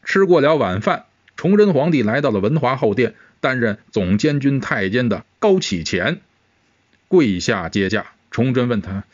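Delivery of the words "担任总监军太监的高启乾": plain reading voice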